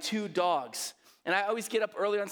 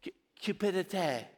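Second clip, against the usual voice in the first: French accent. Shown as a demonstration. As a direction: lead a group in French "cupidité". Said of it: "try it with me".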